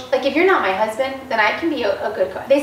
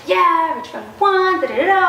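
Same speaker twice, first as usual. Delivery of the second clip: mock pumped up; 7 semitones higher, 3 dB louder than usual. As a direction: ham up the victory buzz. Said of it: "they were all pumped up".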